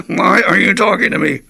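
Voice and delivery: hammed-up exasperated retort to the old timer; exasperated whine. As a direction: throw the question up in mock disbelief. Repeats retort for laughs.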